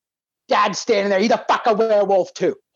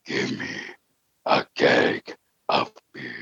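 wolf voice; 5 dB quieter than usual. Retold or imitated. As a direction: drop it way down and drag it out like a monster. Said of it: "red eyes and wolf voice".

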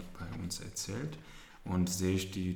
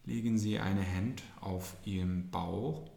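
soothing spa whisper. Acted. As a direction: calmly talk them through breathing. Leads a breathing exercise. (sanfte Atemübungs-Anleitung)

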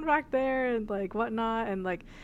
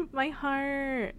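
higher, drawn-out mock swoon; 7 semitones higher, much slower than usual. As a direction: retell it as a touched, slow gush. Retells being moved by song dedication.

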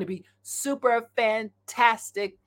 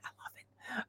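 whisper; 20 dB quieter than usual. Retold or imitated. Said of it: "I'm like".

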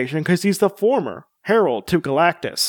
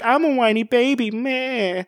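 Silver Surfer whiny; 6 semitones higher than usual. Retold or imitated. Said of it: "Silver Surfer was just like".

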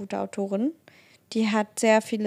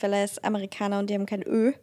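usual speaking voice

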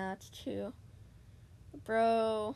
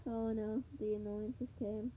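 ordinary talk

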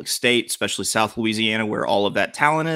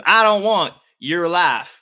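cringey fake Southern drawl, pitched up and slowed; mock Southern accent; 6 semitones higher, 5 dB louder, slower than usual. Mocking a coach's fake accent with a movie quote, exaggerated Southern accent. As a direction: put on a hammy teen-movie Southern twang. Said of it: "That Southern accent made me cringe".

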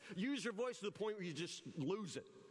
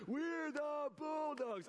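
high, drawn-out pep-rally holler; louder school cheer; 7 semitones higher, much slower than usual. Retold or imitated. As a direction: stretch the chant into a yell. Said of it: "we'll yell a little louder".

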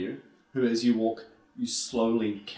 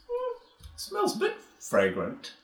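put-on old man voice; 4 dB quieter than usual.

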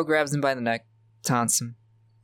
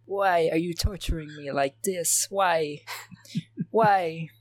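higher plaintive fake French accent; mock French accent; 5 semitones higher than usual. Ash asking his torturers why, in mock French accent. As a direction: wail it higher in badly faked French. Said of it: "If he was French".

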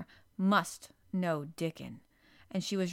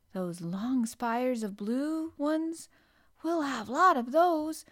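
put-on slow, higher-pitched boyish announcement; announcing; 5 semitones higher, 4 dB louder, slower than usual. A narrator's reading. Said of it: "Colin was announcing".

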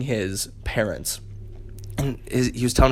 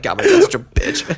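put-on much higher, scornful teen voice; mocking teen kid; 3 dB louder than usual. Teen son scorning his uncool dad, high sneer. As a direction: sneer at your lame father.

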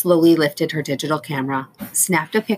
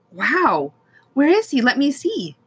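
excited; 9 semitones higher, 3 dB louder than usual. Acted. Boy eager to see rescued man.

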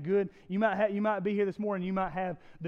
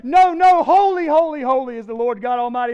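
much louder, higher insistent cry of holy; angelic shout back; 6 semitones higher, 15 dB louder than usual. Acted. Other angel calling back.

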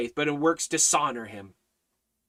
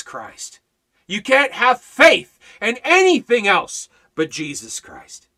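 higher, rising sermon warning; fervent preaching; 6 semitones higher, 7 dB louder than usual. Acted. Preacher warns the church the world will fail them, fervent. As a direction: drive the warning home to the flock.

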